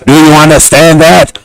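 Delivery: movie quote imitation. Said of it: "He's like".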